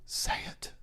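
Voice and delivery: breathy whisper, no voice; whispered quote. Shown as a demonstration. Host demos the quote in a whisper. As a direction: hush it like the character. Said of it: "That's how he says it".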